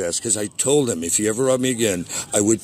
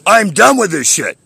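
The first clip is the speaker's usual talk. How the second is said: loud, higher, real anger; furious at the end of his rope; fed-up shout; 9 semitones higher, 9 dB louder than usual. Real, not acted. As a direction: bark it out in disgust.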